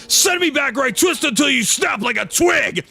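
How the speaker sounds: high, shouted threat; threatening yell. Acted. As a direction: bellow it as an ultimatum. Dirk threatens the being.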